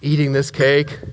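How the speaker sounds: ordinary delivery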